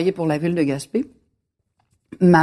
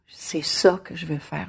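soft, slow near-whisper; hushed resolve; 7 dB quieter than usual. Retold or imitated. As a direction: whisper it like a secret vow, slowly. Retells her childhood awed resolve. (chuchoté, comme une promesse intime)

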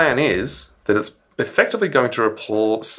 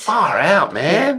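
hippie voice; 9 semitones higher, much slower than usual. Pretend. Hippie riff on segment name.

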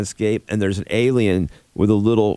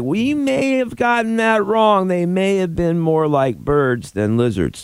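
mock scientists' admission; 8 semitones higher than usual. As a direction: imitate scientists sheepishly backtracking. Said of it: "sending us this little thing like".

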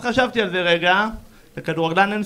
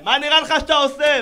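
mock yell; 6 semitones higher than usual. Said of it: "הוא כולו צורח עליי".

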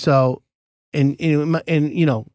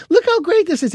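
gushing delight; 12 semitones higher than usual. Retold or imitated. Homeowner showing off flowers.

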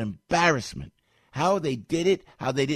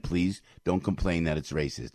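normal talking voice

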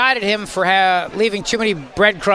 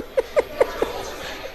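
breathy laugh; 8 dB quieter than usual. Real, not acted. Host laughs at chain smoker.

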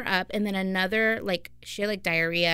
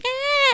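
put-on very high, short baby wail; imitating baby cry; 3 dB louder than usual. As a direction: squeak it like a hurt infant. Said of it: "she did the cry where she was like".

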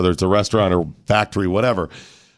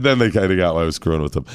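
ordinary delivery

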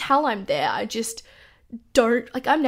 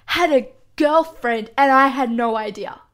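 ordinary delivery

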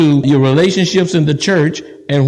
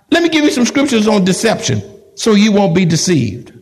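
normal talking voice

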